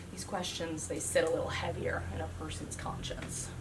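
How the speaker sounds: unvoiced hush on the heavy thought; whisper. Comic lowering her voice to a whisper for the crowd. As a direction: whisper it like a confession.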